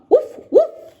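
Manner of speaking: high, loud woof; dog bark. A narrator's reading. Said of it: "says the dog".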